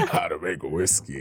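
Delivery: slow, low hollow mask voice. Pretend. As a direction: drone it like a talking mask. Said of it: "in the mask voice".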